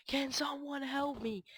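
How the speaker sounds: "help" stretched out high and slow in a drawn-out plea; acting out a typed all-caps "Can someone help me?", slow and high. Shown as a demonstration. Typing in all caps to a silent chat, high and pleading. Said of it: "in all caps and, um, exclamation points".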